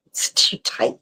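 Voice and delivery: real whispered aside, under the breath. Real, not acted. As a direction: mutter it to yourself, unvoiced and soft. Private aside while adjusting brace.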